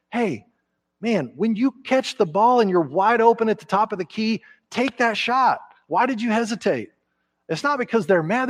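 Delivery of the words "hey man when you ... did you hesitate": a hint of a coach, raised pitch; encouraging coach. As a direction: egg the player on like a coach. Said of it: "what do coaches always have to tell".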